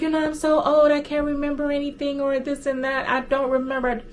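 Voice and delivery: high, strained pretend old lady voice; girl playing a forgetful old grandma; fake old grandma. Girl faking grandma to dodge the agent's question. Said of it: "She's like".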